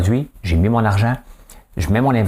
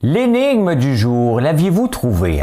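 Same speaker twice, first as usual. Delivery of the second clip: drawn-out, high-pitched show announcer; announcer call; 5 semitones higher, slower than usual. Acted. Host presenting the riddle of the day to viewers, drawn-out announcer voice. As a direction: stretch the words out like a game-show host.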